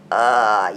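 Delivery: low, loud mock groan; exasperated groan. Mom fed up with boys, exasperated groan.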